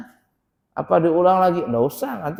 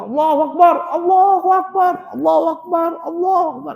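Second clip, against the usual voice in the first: much higher, louder takbir like a battle cry; hammed-up war cry takbir; mock battle cry; 10 semitones higher, 3 dB louder, faster than usual. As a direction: shout it like charging into war. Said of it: "Ini mau jihad atau mau apa ini?".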